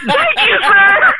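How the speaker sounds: very high, relieved squeak; high-pitched voice. Retold or imitated. Driver thanking the forgiving officer, squeaky high voice. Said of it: "you had the high-pitched voice".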